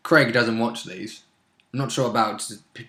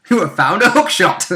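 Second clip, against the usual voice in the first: mock item announcement; 5 semitones higher, 4 dB louder than usual. Mock game announcement of found item.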